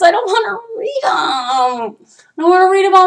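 exasperated rant. Real, not acted. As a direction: whine it out in frustration. Ranting about long texts.

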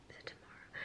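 barely voiced, muttering the question to herself; whispered to herself. Real, not acted. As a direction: drop to a private whisper, unsure. Worried self-question mid-vlog.

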